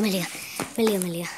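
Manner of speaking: low hushed urging; whispering while hiding. Real, not acted. Hushing kids while hiding.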